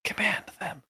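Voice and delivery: hushed command to a bee; soft, secret order; whisper. Acted. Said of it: "I whisper to Barry".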